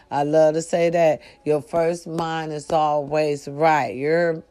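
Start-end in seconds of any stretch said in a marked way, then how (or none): none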